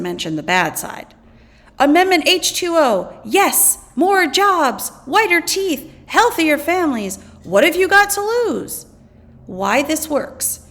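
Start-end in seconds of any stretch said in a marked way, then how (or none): 1.8-8.9 upbeat, higher-pitched sales pitch. Acted. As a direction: rattle off the perks like an eager advertiser. Acted ad for card stacking.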